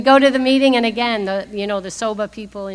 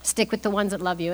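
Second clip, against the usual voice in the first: "Stick with the ones that love you." repeated softer and gentler; 2 semitones lower, 7 dB quieter than usual. Retold or imitated. Old timer Milton repeating his advice, softer. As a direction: ease off on the repeat. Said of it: "And he says".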